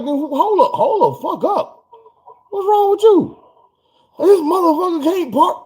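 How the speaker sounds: high-pitched imitated cussing; hammed-up furious girlfriend; mock rant. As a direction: squeal it like she's going off.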